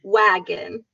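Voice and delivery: ordinary talk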